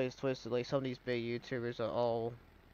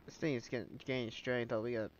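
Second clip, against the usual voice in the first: blocked nose, congested voice; talking through a stuffy nose; stuffed-up nose; 2 semitones lower, 9 dB quieter than usual. Real, not acted. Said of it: "I am so stuffed up right now".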